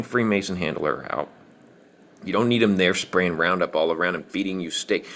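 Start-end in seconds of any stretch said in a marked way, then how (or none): none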